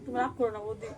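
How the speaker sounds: low, appeasing plea; pleading. Acted. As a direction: beg her not to be angry. Maid begs employer not to be angry. (মিনতির সুরে অনুনয়)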